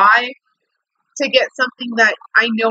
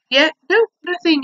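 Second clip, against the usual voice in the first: sarcastic disbelief; 7 semitones higher than usual. Real, not acted. Sarcastic about no email reply.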